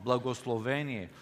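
ordinary talk, nothing marked

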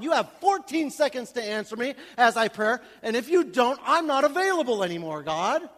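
1 demanding prayer to God. Retold or imitated. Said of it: "you can't tell God".

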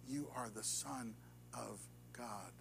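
very soft, slow, near whisper; reverent hush. Acted. Preacher echoing the disciples, soft and reverent. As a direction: let it fall to a hush. Repeating the confession in awe.

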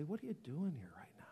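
quick, soft half-whisper; hushed divine question. Acted. You hear God questioning you, in a hush. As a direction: slip it in quietly, almost whispered.

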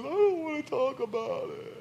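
put-on high, sulky child's voice; a six-year-old refusing to talk, sulking; pouty little daughter. Father imitating his crying daughter, high and sulky. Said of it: "And so she says".

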